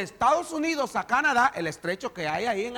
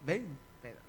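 soft low beckoning; calm invitation; 6 semitones lower, 9 dB quieter than usual. Acted. Jesus inviting Peter onto the water, soft low call. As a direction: gently call him over. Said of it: "Jesús le dijo".

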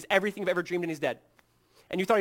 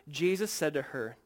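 normal talking voice

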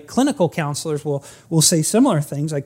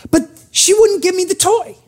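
put-on much higher, louder childish whine; whiny kid excuse; 12 semitones higher, 5 dB louder than usual. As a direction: whine like a boy shifting the blame. Billy voiced blaming Annie.

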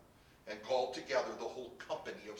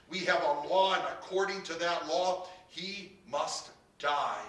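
loud, emphatic, slowing at the end; insistent; 5 semitones higher, 7 dB louder than usual. A narrator's reading. Accusers press their law on Pilate, insistent. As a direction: insist forcefully, driving the point home.

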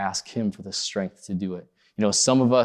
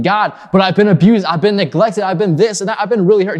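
high, loud, aggrieved pleading; pleading complaint; 8 semitones higher, 8 dB louder than usual. Retold or imitated. Hurting believer pleads with God, high and loud. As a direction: cry out your hurt, much higher and louder. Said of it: "you're sitting here with God saying".

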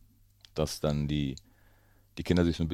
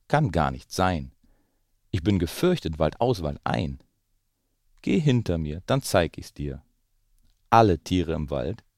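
put-on lively read-aloud voice for children; story narration; 3 semitones higher, 5 dB louder, slower than usual. A narrator's reading. Parent reading The Gruffalo to the children, lively storytelling. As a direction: tell it like a bedtime story. Reads picture book aloud.

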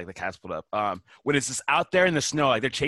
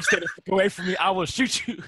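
mock threat; 7 semitones higher, 3 dB louder than usual. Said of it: "He's like".

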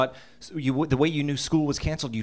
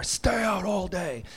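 gruff dad; 5 semitones higher, slower than usual. Retold or imitated. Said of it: "he slammed the door".

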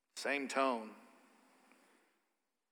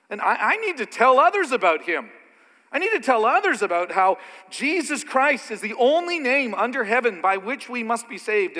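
loud, higher, driving; urgent preaching; 5 semitones higher, 5 dB louder than usual. Real, not acted. Preacher urging the congregation to tell others, loud.

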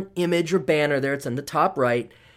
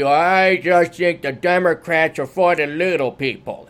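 slow, lightly voiced naive friend; mock Democrat buddy; 3 dB louder, slower than usual. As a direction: parrot the buddy's earnest line, slowly. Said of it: "lifelong Democrat buddy".

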